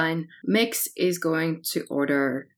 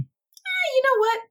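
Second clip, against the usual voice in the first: surprised squeak; 3 dB louder than usual. Acted. Character surprised by barman.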